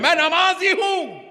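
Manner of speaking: very high-pitched imitated boast; proud boast. Preacher imitating those proud to pray, boastful. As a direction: proclaim it high, mimicking pride. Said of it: "بڑے فخر سے کہہ رہے ہیں".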